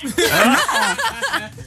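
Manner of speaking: big high laugh. Real, not acted. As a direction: burst out laughing. Host laughs at mother's threat.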